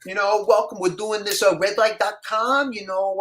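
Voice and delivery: stocky casting guy welcoming the actor, sleazy and high; sleazy producer. Retold or imitated. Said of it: "your total Ron Jeremy".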